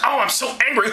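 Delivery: high, hammed-up fury; mock anger. Stripping mob angry at Paul, mock furious. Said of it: "they're really pissed".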